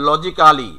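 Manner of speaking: "logically" as loji-KAA-li; every written syllable of "logically" sounded; local "logically". Shown as a demonstration. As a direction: say "logically" with a full "kaa" before "li". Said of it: "আমরা উচ্চারণ করি কিন্তু এভাবে".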